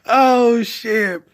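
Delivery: high exclamation, recovering from real laughter; laughing to tears. Real, not acted. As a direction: catch your breath after cracking up. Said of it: "like, literally crying on".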